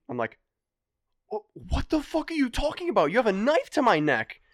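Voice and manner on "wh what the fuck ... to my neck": high, loud frightened protest; alarmed outburst. Retold or imitated. Knife at his neck, yelling at his friend, high and loud. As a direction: yell it in disbelief.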